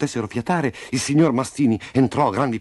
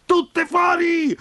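high, drawn-out furious shout; enraged yell; much slower than usual. A narrator's reading. Enraged rooster ordering hens out, yelling. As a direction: scream them out of there. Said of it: "urlò il galletto inferocito".

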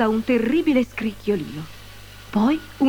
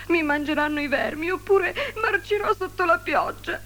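much higher, despairing tree voice; tearful lament; 10 semitones higher than usual. A narrator's reading. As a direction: wail over your sad fate. (lamento disperato, molto acuto)

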